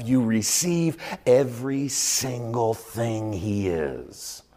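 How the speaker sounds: usual speaking voice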